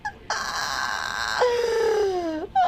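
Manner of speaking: long laugh trailing into a wheeze; helpless laughter. Real, not acted. Streamer tickled by the shoelaces, helpless laughter. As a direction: laugh till it turns into a sigh.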